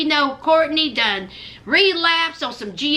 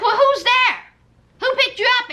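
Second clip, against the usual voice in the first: imitating Will; 8 semitones higher, faster than usual. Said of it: "And he was like".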